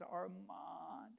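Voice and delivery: soft imitated call from a worn-out voice; a spent, quiet shout, drawn out; hoarse, weakened call. Father calls after thirty-eight hours of digging, hoarse weak call. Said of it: "his voice is hoarse".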